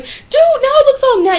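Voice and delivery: loud, squeaky mock complaint; high-pitched protest. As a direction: pitch it way up in retold dismay. Acted complaint about peeled label.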